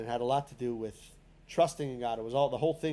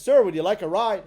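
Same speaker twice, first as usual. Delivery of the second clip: the wagon driver calls out louder, offering a ride; loud friendly offer; 5 semitones higher, 8 dB louder than usual. Retold or imitated. Wagon owner offers the tired peddler a ride, calling out loudly. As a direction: hail the old man from the wagon, loud and friendly.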